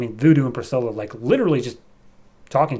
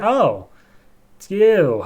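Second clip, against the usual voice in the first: higher, offhand and unimpressed; casual dismissive; 7 semitones higher, 4 dB louder, much slower than usual. Retold or imitated. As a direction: toss off it's you like it barely matters. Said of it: "she's like".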